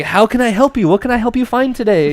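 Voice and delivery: high-pitched, gushing customer service; hella enthusiastic. Retold or imitated. Smoke shop clerk offering to help a customer, hella enthusiastic. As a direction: imitate an over-eager clerk offering help. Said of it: "He's, like, hella enthusiastic".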